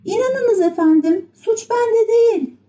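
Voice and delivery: very high, pleading puppet voice; Pinokyo. A narrator's reading. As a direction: protest innocence in a tiny high voice. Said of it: "Pinokyo kendini savunmaya çalıştı". (kendini savunan tiz kukla sesi)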